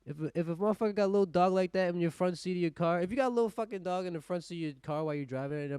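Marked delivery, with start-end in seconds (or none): none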